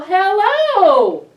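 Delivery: big called-out hello; loud hello. Acted. Librarian shouts a big hello to the children. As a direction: call hello across the room.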